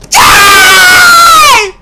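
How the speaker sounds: high piercing shout. Real, not acted. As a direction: yell out in sudden alarm. Streamer shouts when chased.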